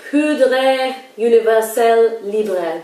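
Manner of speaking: slow, hammed-up French accent, drawn out; awful French accent. Shown as a demonstration. Vlogger naming her Chanel powder in a mock French accent. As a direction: say the French name in an overdone French accent. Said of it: "Do you like my awful French accent?".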